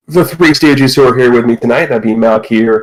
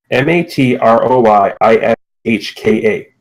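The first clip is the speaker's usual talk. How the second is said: normal talking voice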